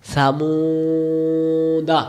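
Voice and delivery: very slowly stretched reading of Samuda; drawn-out reading. Shown as a demonstration. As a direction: hold each sound of Samuda long, like a recitation. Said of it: "spelling then reading word". (Samuda শব্দটি খুব ধীরে টেনে টেনে পড়া)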